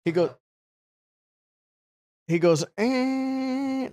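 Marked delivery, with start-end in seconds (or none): 2.8-3.9 long high held "eeeh" squeal; imitated skid noise. Storyteller mimics the car spinning out, high imitated squeal. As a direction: mimic a car sliding out of control. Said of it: "like that".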